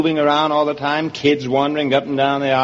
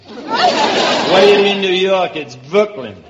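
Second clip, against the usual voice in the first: indignant Brooklyn native; hammed-up Brooklyn accent; 3 semitones higher, 3 dB louder, slower than usual. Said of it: "Do I come from Brooklyn?".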